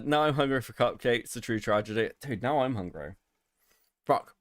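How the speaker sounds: normal talking voice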